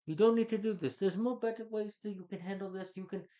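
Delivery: pleading, high-pitched mock appeal; high, urgent retold plea; Colossus. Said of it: "Colossus tries, I was like".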